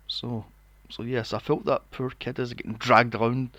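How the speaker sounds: usual speaking voice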